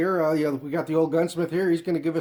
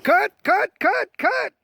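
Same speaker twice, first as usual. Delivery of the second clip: calling cut; 5 dB louder, much slower than usual. Real, not acted. Director stops the skit.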